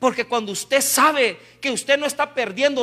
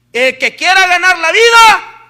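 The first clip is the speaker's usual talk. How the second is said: high, slamming shout of scripture; full-force preaching; 9 semitones higher, 14 dB louder than usual. Real, not acted. Preacher quotes scripture against the over-careful, shouted rebuke. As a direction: thunder the verse at them.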